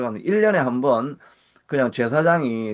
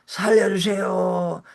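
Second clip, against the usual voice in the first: very slow, higher, rising to a shout on 살려주세요; desperate cry for help; 6 semitones higher, much slower than usual. Shown as a demonstration. Pastor voicing a prayer in crisis, desperate pleading shout. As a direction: cry out as if falling into the abyss. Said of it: "이런 기도가 나오지 않습니까?".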